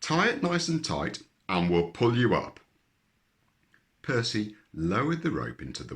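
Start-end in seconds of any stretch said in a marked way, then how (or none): 0.0-2.6 calling down. A narrator's reading. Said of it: "called Percy".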